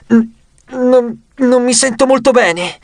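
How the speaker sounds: high, stammering queasy voice; feigned sickness. Pretend. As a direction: stammer as if about to be sick. Hazel fakes sickness to the guard. (finto malessere, balbettando)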